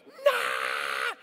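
loud mock scream of terror; child's scream. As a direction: scream like a scared little boy. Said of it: "He starts screaming".

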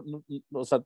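usual speaking voice

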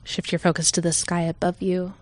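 calm, unhurried meditation voice with long pauses; guided meditation. Real, not acted. Leader guiding listeners to settle in, calm meditation voice. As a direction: ease listeners gently into stillness.

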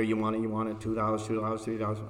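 lightly voiced auctioneer chant. Shown as a demonstration. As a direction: rattle it off like an auctioneer. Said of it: "I'm gonna talk like a auctioneer".

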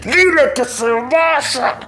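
very high, very slow mock cry; savages urging. A narrator's reading. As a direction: push him to send the visitor away. Savages urge.

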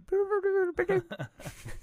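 very high robot beeping noises; R2D2 beeps. Pretend. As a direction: squeak like a little droid. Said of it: "That was my R two D two".

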